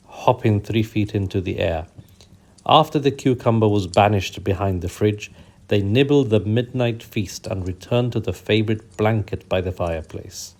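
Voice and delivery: plain reading voice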